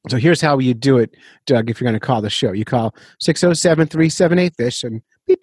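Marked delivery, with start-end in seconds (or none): none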